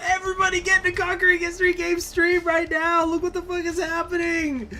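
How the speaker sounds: high, loud hyped call; rally everyone in excitement; excited shout. Retold or imitated. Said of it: "going like".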